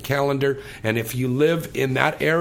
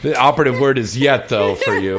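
laughing; 6 dB louder than usual. Real, not acted. Teasing joke after guest's remark.

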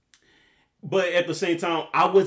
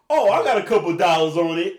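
mock boast; 2 semitones higher, 7 dB louder than usual. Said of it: "this fucker ... said".

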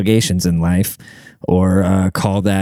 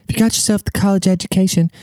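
much higher, quick, prim educated voice; mock a college graduate, fast and high; 9 semitones higher, faster than usual. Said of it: "probably sound more like that".

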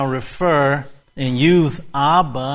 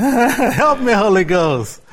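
high start, said through laughter; laughing call-out; 6 semitones higher, 4 dB louder than usual. Retold or imitated. Preacher laughing as he repeats the pastor's line. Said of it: "he actually used to say".

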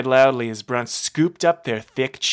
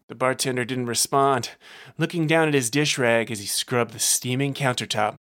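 ordinary delivery

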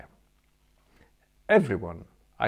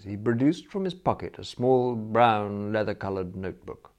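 ordinary delivery

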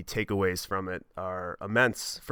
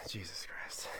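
soft breathy whisper; embarrassed hushed aside; 16 dB quieter, slower than usual. Real, not acted. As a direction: mutter it to yourself. Embarrassed aside after story.

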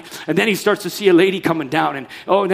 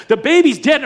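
anguished; 8 semitones higher, 7 dB louder than usual. Acted. Preacher reliving turmoil, building.

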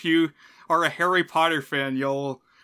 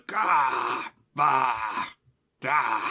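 imitated campy villain outbursts, drawn out and falling; villain's exclamations; much slower than usual. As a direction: mimic a hammy villain's open-mouthed "gah, bah, da". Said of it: "ironically impressive line delivery".

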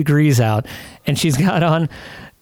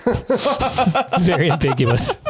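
ordinary delivery

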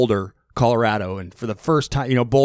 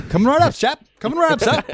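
much higher, hammed-up British butler voice; mock British accent; 13 semitones higher, faster than usual. Mocking John Wayne as a British cowboy, hammed-up British accent. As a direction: chirp it in an over-the-top British accent. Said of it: "all Britishized".

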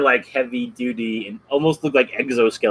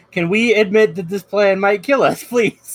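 pleading coworker; 6 semitones higher, 4 dB louder than usual. Retold or imitated. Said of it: "That's what he's like".

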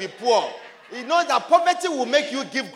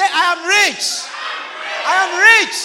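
declare it loud; 7 semitones higher, 6 dB louder than usual. Shown as a demonstration. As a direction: proclaim it with full force.

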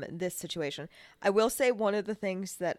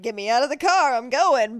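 loud, higher, impatient; impatient demand; 5 semitones higher, 10 dB louder than usual. Retold or imitated. Imagining being carried out of the car, impatient and loud. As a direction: demand it like you're done waiting. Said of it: "I'd be like".

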